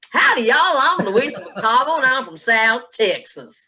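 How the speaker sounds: high, loud, laughing "Howdy, y'all"; big Texan greeting. Shown as a demonstration. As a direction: belt it out like a stage opener. Trying new speech intro in mirror.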